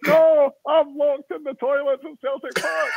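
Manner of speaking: high, small, slow plaintive voice; a wee man stuck in the toilets explaining himself; Jim Spence wee voice. Retold or imitated.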